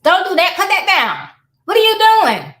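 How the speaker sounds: loud, high scolding shout; yelling. Acted. Parent ordering a misbehaving child around, yelling. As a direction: yell sharp orders at a child. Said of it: "do we have a sense of yelling?".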